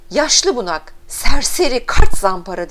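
very loud, punched name-calling; shouted insults. A narrator's reading. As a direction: hurl each insult at full volume. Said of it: "Birden avazı çıktığı kadar". (avazı çıktığı kadar hakaret)

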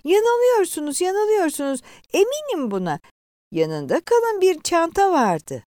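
high-pitched, pleading protest; upset woman refusing to believe it; tearfully insisting. A narrator's reading. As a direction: insist desperately, close to tears. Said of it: "gözleri yaşlı diretiyordu".

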